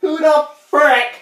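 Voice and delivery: high, loud, slow hammed-up rant; over-the-top fury at a caller; outraged shouting. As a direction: stretch each shouted word in exasperation. Ranting about an unknown caller.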